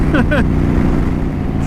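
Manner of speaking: airy high laugh; breathy laugh. Real, not acted. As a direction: laugh under your breath. Joke about not riding.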